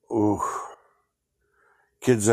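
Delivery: ordinary talk, nothing marked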